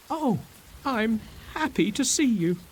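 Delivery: high, delighted greeting; happy. A narrator's reading. Trinculo greeting Stefano with high delighted relief. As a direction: burst with relief. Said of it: "he says to Stefano".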